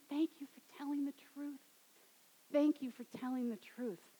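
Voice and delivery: hushed, tender gratitude; tender thanks. Retold or imitated. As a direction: thank her gently, like comforting someone. Jesus gently thanks the woman.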